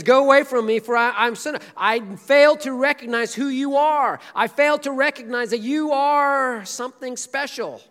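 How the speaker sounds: loud, high, desperate cry; anguished plea. Retold or imitated. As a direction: beg him to leave you. Peter's anguished confession.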